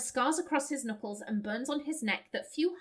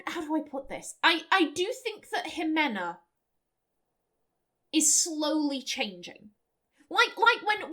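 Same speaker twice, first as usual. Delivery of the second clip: normal talking voice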